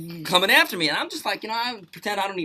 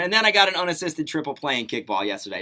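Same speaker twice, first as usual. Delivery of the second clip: ordinary talk, nothing marked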